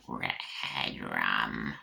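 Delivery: very low, drawn-out spooky voice; creepy croak. Pretend. Aside on the crime-scene Red Room, deep spooky voice. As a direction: stretch it out deep and ominous. Joke voice, then says sorry.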